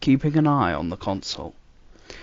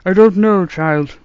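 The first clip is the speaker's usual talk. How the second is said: put-on higher, puzzled elderly doctor; musing old man, baffled; puzzled grandfather voice; 9 semitones higher, 13 dB louder than usual. A narrator's reading. Doctor replies.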